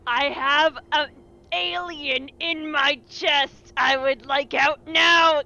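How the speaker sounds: ordinary talk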